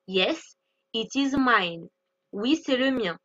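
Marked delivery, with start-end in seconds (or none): 0.1-1.9 "Yes, it is mine." spelt out slowly, every word clear; slow model sentence. Shown as a demonstration. Teacher modelling an answer for her students, slow and clear. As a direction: say it slowly so learners can repeat. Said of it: "je vais dire".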